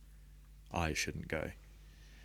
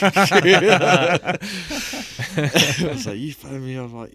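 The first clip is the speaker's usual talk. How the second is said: loud, high laugh bursting through the word; 12 semitones higher, 18 dB louder, much slower than usual. Real, not acted. Laughing hard as he recalls the call with his mum.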